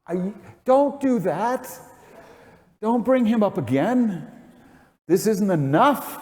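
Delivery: high pleading cry of protest; scream out. Pretend. Preacher voices a protesting cry to God, high and pleading. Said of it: "you want to scream out".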